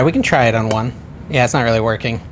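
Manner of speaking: normal talking voice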